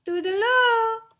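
high, loud little mouse call; singsong farewell. Acted. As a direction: call out cheerily, pitched high. Mouse calls farewell while leaving.

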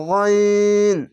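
গইন with rounded aw, no aa, held long; chant-like, drawn-out ghawin; thick letter. Shown as a demonstration. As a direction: stretch a heavy, level গইন. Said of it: "আকার উচ্চারণ নেই".

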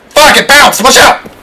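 high urgent yell to scatter; panicked shout. Real, not acted. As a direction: scream a warning to get clear. Grenade panic in firefight.

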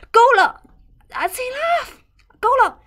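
够了 yelled high and loud, fed up; fed-up mom-stopping shout of 够了. Shown as a demonstration. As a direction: shout 够了 to make the nagging stop. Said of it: "depend on the tone".

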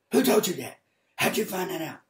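loud, high startled questions; alarmed demand. Retold or imitated. Adulterer confronting the preacher who knows his secret, alarmed. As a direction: act out someone caught out, indignant. Acted accused man, caught out.

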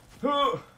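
high startled gasp; sharp gasp. Real, not acted. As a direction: gasp in sudden alarm. Tense moment in game.

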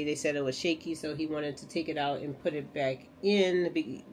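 ordinary talk, nothing marked

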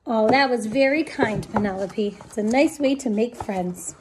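plain reading voice

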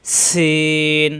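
"সিন" with whistled s and long-held vowel; articulation demo. Shown as a demonstration. Teacher shows air escaping on the letter, drawn-out "সিন". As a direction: hiss into "সিন" and draw it out slowly. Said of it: "বাতাস বের হবে".

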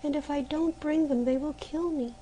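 desperate plea. A narrator's reading. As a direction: plead for help, near tears. Maruschka begs the months.

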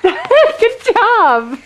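high, loud laughing praise. Real, not acted. Praising child after counting.

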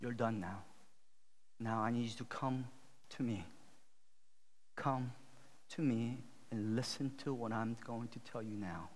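low, soft, measured; calm authority. Acted. Elijah to the people after the frenzy, quiet and firm. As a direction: quietly take command with long pauses.

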